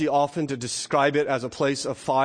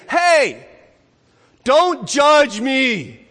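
high, loud, drawn-out objection; mock-offended holler, stretching each word; indignant protest; 9 semitones higher, 8 dB louder, much slower than usual. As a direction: push back hard, slow and loud. Acts people objecting to being judged.

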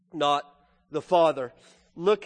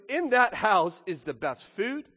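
ordinary talk

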